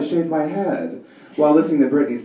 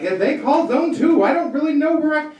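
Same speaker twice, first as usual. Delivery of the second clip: high, whiny airhead voice; mock confused passenger; 12 semitones higher than usual. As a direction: play the clueless flyer, pitched up. Mocking confused airport passenger.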